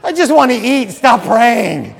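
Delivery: loud, high, laughing "stop praying"; impatient hungry eater. Retold or imitated. Hungry guest groaning at a long grace. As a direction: blurt it out like a starving diner cut short. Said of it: "It's like".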